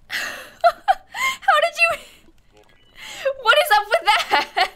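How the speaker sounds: high, squeaky voice breaking with laughter; helpless laughing disbelief; laughing hard. Real, not acted. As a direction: barely get the words out through laughter.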